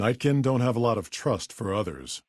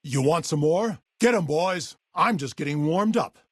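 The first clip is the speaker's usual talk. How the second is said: raised-pitch battle taunting; combat taunt; 7 semitones higher than usual. Acted. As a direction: goad the enemy mid-fight. Combat taunts during a fight.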